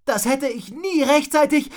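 loud, high-pitched exclamation; excited call. A narrator's reading. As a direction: call it out until a sneeze interrupts. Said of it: "rief Rotznase". (laut und hoch rufen)

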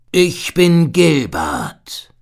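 slow, friendly big seal; slow, hearty seal creature. A narrator's reading. Seal introduces himself. (langsame, gutmütige Seehundstimme)

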